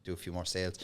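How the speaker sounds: soft, breathless private aside; muttered aside. Retold or imitated. Telling himself he'd stay anyway, under his breath. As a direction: say it under your breath, shrugging it off. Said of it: "And I was like".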